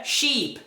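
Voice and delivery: slow "sheep" with drawn-out EE; long Portuguese I in "sheep"; wrong long vowel. Shown as a demonstration. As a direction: say "sheep" as sheeep. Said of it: "Não".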